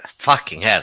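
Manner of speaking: normal talking voice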